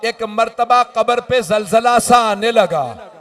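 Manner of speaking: slow, loud, high mournful preaching; wailing recitation. Acted. Preacher to the mourning gathering, loud slow lament. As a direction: cry out the lament slowly to the mourners.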